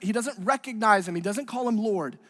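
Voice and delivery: ordinary talk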